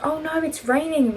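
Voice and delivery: high mock Australian accent. Reenacting the rain joke, high Australian accent. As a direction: put on a high-pitched Australian voice. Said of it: "in an Australian accent".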